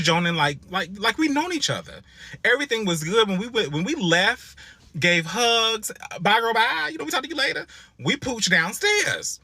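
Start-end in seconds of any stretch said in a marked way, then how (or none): 6.2-7.6 high, friendly mock goodbye; cheerful goodbye. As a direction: chirp a sweet fake-nice farewell. Acted goodbye to mall girl.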